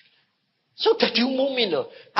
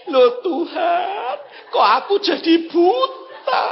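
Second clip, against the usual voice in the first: higher, slow mock lament; bewildered blind man; 7 semitones higher, 3 dB louder, slower than usual. Preacher mimicking the man who turned blind, high drawn-out lament. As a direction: stretch it out high and helpless. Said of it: "Dia ngomong gini".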